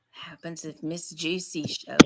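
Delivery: hushed conspiratorial whisper. Acted. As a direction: whisper it like a secret.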